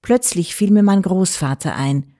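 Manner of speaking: ordinary delivery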